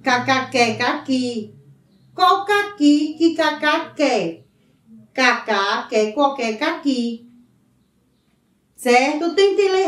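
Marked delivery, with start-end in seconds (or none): 0.0-7.3 reading the tongue twister slowly, syllable by syllable. Shown as a demonstration. Teacher reads the tongue twister to pupils, slowly. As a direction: spell out each k sound carefully. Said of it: "Leia o trava-línguas a seguir".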